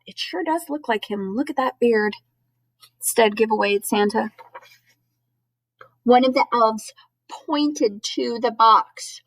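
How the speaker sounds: ordinary delivery, nothing marked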